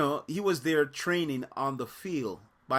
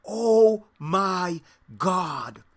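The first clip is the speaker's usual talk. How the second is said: put-on slow, stretched, louder Saudi-accented amazement; imitating his accent; 5 semitones higher, 8 dB louder, much slower than usual. Friend's Saudi accent, amazed at the juggling. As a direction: drawl it out in a mock Arabic accent. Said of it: "he speaks with an accent".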